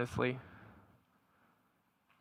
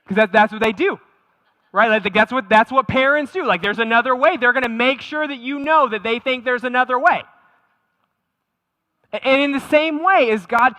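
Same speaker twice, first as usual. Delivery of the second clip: emphatic preaching; 8 semitones higher, 10 dB louder than usual. Real, not acted. Preacher building to emphatic point.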